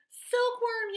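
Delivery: loud high shout of the name; yell. A narrator's reading. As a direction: yell for the silkworm urgently. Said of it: "yelled James".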